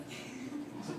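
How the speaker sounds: barely audible, voiceless aside; breath-only muttered lead-in; stunned whisper. Retold or imitated. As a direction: whisper it in mock disbelief. Stunned, voiceless reaction to the phobia.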